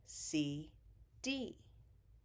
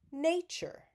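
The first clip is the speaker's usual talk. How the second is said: Nature with NAY pitched up high; stress on NAY; 8 semitones higher, 3 dB louder than usual. Shown as a demonstration. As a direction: lift the first syllable of Nature, NAY-ture. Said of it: "Nay is the stressed syllable".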